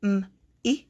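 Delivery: "M, I" as "mmm ... ih"; M hummed, I as "ih", pause between; isolated sounds. Shown as a demonstration. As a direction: sound out M, then short I. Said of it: "vowel sounds do you hear".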